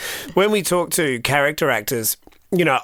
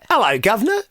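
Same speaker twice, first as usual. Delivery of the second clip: Cockney greeting; 6 semitones higher than usual. Retold or imitated. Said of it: "or like".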